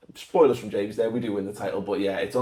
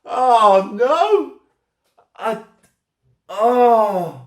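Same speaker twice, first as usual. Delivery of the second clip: shocked shriek; 12 semitones higher, 6 dB louder, much slower than usual. Real, not acted. Friend's brutal question sprung on him.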